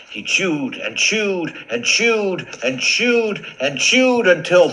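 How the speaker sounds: mounting storyteller excitement; building narration. A narrator's reading. Narrator builds through repeated chewing.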